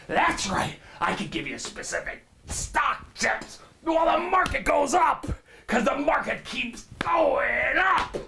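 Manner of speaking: screaming, high-pitched stock guru pitch; manic money show host. Acted. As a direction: sell it like a frantic TV finance host.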